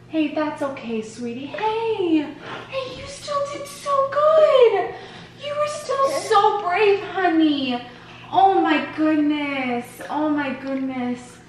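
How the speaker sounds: high, slow soothing words. Real, not acted. Mother comforting upset baby.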